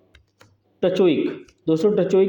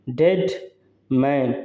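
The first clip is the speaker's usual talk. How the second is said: डेड held, pause, मैन held and lower; two long syllables; 4 semitones lower, much slower than usual. Shown as a demonstration. Teacher showing a spondee to students, डेड, मैन both held long. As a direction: stretch डेड and मैन as separate beats. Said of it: "long syllable हो गया".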